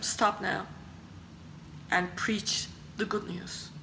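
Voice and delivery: low, hushed command, fading near a whisper; God's quiet voice. Retold or imitated. God tells the running man to stop and preach, hushed command. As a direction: quietly give the order, low and soft.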